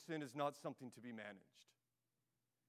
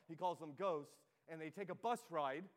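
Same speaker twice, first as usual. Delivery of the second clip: ordinary delivery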